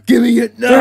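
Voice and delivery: demanding shout. Acted. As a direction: shout the demand, loud and high. Demanding wrapping paper from clerk.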